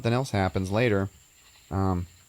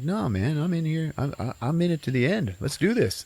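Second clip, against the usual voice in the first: high-pitched imitation of keen loyalty; eager commitment; 8 semitones higher than usual. Boyfriend refusing to leave her, imitated eager commitment. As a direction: pledge yourself eagerly, pitched well up.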